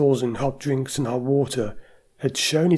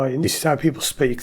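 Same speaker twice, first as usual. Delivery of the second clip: normal talking voice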